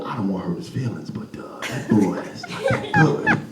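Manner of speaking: soft low murmur; confiding aside. Acted. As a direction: whisper so the boy won't hear. Aside about Miles.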